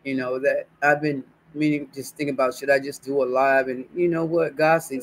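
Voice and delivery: ordinary talk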